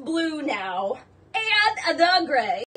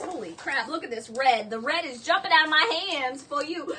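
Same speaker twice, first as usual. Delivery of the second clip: ordinary talk, nothing marked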